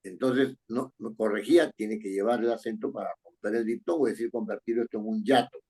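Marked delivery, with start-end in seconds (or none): none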